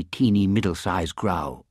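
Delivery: ordinary delivery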